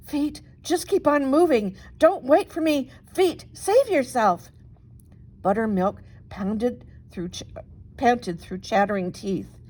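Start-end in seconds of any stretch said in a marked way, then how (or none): 0.1-4.5 high, urgent plea to her own feet; frightened plea. Acted. Bunny flees the banshee, pleading high and urgent. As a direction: beg your feet to save you.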